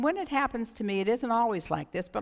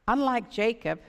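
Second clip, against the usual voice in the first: ordinary talk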